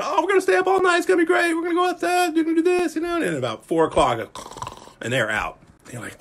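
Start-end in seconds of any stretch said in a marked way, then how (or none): 0.0-3.2 high, shouted mock bragging; bragging kids. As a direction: hype it up like kids bragging they'll stay up all night. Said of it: "all those who are bragging".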